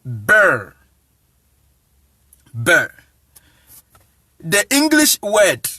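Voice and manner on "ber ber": "ber" low and isolated, with an open vowel; Akan pronunciation demo. Shown as a demonstration. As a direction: say "ber" as a single low syllable, then repeat it. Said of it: "Akan word is".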